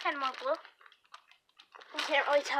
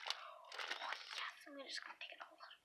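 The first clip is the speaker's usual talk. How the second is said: whispering; 16 dB quieter than usual. Real, not acted. Quiet whisper to herself.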